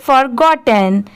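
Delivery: "forgotten" run together as one word; at speed. Shown as a demonstration. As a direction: join for, got and ten in "forgotten" without pauses. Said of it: "अब इसे speed में पढ़ेंगे". ("forgotten" को speed में, बिना रुके एक साथ बोलें)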